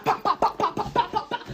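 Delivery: loud high bah bah sound effects; mock fight noises; imitated punches.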